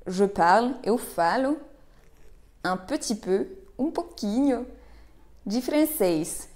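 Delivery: ordinary talk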